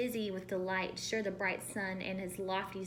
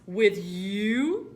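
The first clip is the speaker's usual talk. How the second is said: astounded; 8 dB louder than usual. Acted. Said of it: "the yellows astounded".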